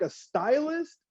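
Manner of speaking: high, sharp disbelieving "A stylus?"; incredulous retort. Retold or imitated. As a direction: scoff at them in sheer disbelief. Mocking kids' Apple Pen claim.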